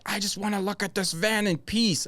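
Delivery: high, put-on irritated complaint; pissed-off customer. Salesman imitating a customer who wants to be left alone, pissed off. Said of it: "he's just pissed".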